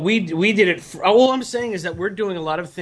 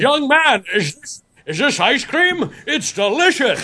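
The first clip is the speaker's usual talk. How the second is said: mock grand gentleman; 5 semitones higher than usual. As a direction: declaim it like a regal orator. Playing the man as delighted.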